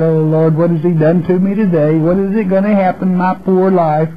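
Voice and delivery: mock self-pitying whine. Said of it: "keep repeating this burden of God".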